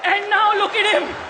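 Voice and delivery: roaster keeps railing at Hrithik, escalating mock rage; mock outrage, building.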